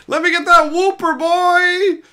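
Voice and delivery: high, loud hyped-up yell; hype shout. Pretend. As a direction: shout the order like a hype man. Hyped fast-food order shout.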